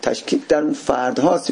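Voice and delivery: normal talking voice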